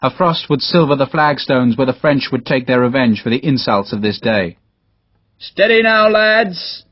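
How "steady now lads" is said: steadying shout. Acted. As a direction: hold the nervous men together. Officer steadying troops in battle.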